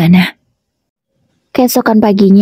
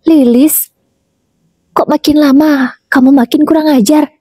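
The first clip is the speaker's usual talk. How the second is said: higher, indignant scold; mother-in-law rebuking her daughter-in-law, higher; 7 semitones higher than usual. A narrator's reading. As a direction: call her out for being insolent.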